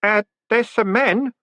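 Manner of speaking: a nervous grin you can hear; uncertain boy. A narrator's reading. Stanley telling Moist about the men, uncertain boy voice. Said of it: "grinning uncertainly".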